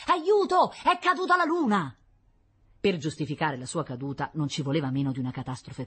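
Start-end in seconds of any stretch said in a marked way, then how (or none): 0.1-2.0 high, loud cry for help; terrified baker's boy crying out; panicked shout. A narrator's reading. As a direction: scream it fleeing into the shop.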